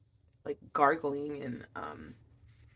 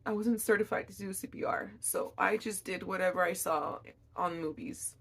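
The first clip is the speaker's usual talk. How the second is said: usual speaking voice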